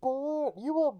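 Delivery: high, slow distorted Bane impression; Tom Hardy's Bane voice. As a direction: drawl it out as Bane. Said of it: "That's what he sounds like".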